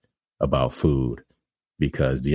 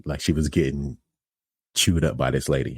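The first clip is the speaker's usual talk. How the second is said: normal talking voice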